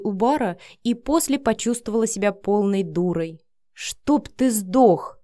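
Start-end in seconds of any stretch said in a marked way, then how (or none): 3.7-5.2 very slow, drawn-out angry growl; growled curse. A narrator's reading. Vika yanking at the front door, growling with anger. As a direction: growl each word of the curse. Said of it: "прорычала Вика".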